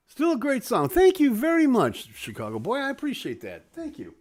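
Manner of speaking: ordinary talk, nothing marked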